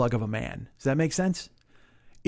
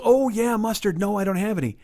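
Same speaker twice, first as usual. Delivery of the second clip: high, sing-out retort; mock agreeable reply; 6 semitones higher than usual. Friend answers odd question about mustard, mock agreeable. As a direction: imitate a friend playing along, pitched up.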